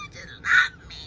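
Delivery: very high whining plea through laughter; whiny laughing plea. Retold or imitated. Mother retelling pleading her toddler for love, high and whiny with laughter. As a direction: beg in a squeaky laughing whine. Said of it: "And I'm like".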